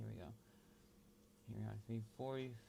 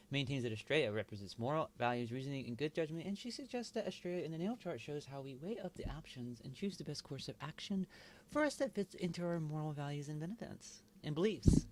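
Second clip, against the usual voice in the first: higher-pitched lady from a commercial; feminine commercial voice; 5 semitones higher, 3 dB louder than usual. Real, not acted. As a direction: read it out like a woman in an advert. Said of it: "female voice".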